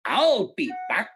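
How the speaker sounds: slow, deliberate put-on film hero voice; a deliberately rough action-hero impression; bad film impression. Host performs film clue for quiz, slow put-on hero voice. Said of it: "if I did the real impression".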